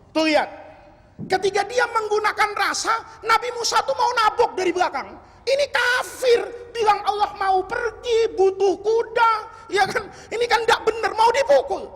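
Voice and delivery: a preacher carried away mid-sermon; preaching at full force. Acted. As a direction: drive the story up to its peak. Preacher builds to a peak.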